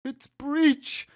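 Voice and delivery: plain reading voice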